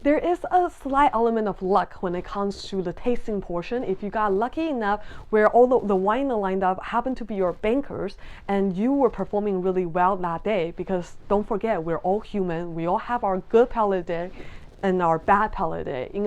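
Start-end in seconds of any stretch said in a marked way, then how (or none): none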